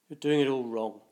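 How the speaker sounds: grumpy mumble. Acted. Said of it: "mumbled grumpily under his breath".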